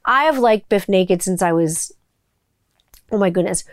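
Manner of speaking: normal talking voice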